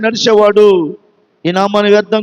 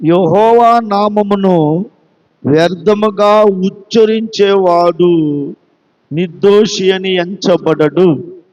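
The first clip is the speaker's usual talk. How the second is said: slow and lower, every word weighed; slow scripture reading; 5 semitones lower, slower than usual. Shown as a demonstration. Preacher reads the commandment to his church, slow and solemn. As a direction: read the commandment out slowly and solemnly. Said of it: "నిర్గమకాండము ఇరవై అధ్యాయం ఏడవ వాక్యం చూడండి".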